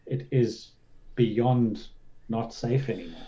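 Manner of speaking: ordinary delivery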